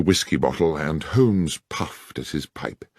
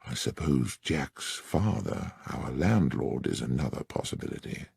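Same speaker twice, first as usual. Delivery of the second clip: softly lowered voice; dropped voice; 3 semitones lower, 8 dB quieter than usual. A narrator's reading. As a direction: keep it low so the landlord won't hear. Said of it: "dropping my voice".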